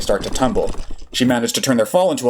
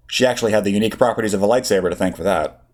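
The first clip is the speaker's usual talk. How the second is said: ordinary delivery, nothing marked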